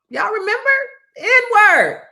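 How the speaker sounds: high, loud disbelief; stunned it was said at all; incredulous. Real, not acted. Incredulous retelling of remark.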